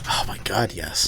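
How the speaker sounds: low breathy whisper of delight. Real, not acted. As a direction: whisper it like a confession. Whispered agreement.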